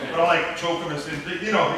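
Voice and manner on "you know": ordinary talk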